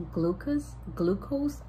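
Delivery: "glucose, glucose" tried tentatively, rising in question; unsure pronunciation. Real, not acted. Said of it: "I don't know how to say it".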